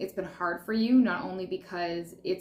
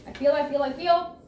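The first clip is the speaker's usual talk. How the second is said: higher, louder, searching repeated feel; rising intuitive channeling; 7 semitones higher, 4 dB louder than usual. Real, not acted. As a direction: reach up as the feeling comes through. Reader channeling a feeling aloud.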